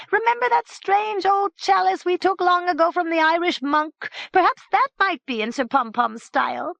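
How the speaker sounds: high, eager servant voice; high servant answering, obliging; Nopt the servant. Acted. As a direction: answer the master dutifully. Nopt recalls the Irish chalice.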